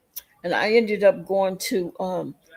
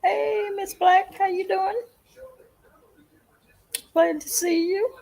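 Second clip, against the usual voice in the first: sing-song greeting; 12 semitones higher than usual. Acted.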